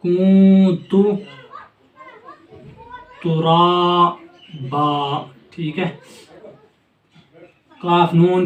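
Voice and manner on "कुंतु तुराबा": "कुंतु तुराबा" drawn out very slowly, the nasal hidden in "कुंतु"; slow tajweed demo. Shown as a demonstration. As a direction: hide the n of "कुंतु" and stretch "तुराबा". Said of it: "कुन नहीं पढ़ना है".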